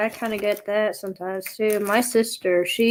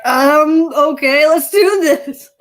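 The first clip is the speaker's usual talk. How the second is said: louder, slow, laughing go-along; mock resigned; 6 semitones higher, 7 dB louder, much slower than usual. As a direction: give in with a laugh.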